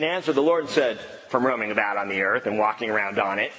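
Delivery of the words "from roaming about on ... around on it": low, quick, sinister Satan voice. Acted. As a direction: play Satan answering, low and hurried. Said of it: "That's my best Satan voice".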